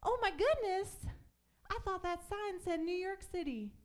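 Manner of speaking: a friend's mom gushing; surprised mom. Retold or imitated. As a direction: gasp like a mom seeing a sign. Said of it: "she said".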